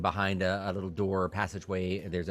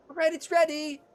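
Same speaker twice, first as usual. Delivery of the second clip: high, eager innkeeper voice; fussy innkeeper; 6 dB louder than usual. Acted. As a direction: play a flustered, helpful innkeeper pitched high. Innkeeper waves them over.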